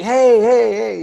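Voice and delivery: high, loud hey hey hey; greeting colleagues from the conference, cheery and loud. Retold or imitated. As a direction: hail old friends across the room.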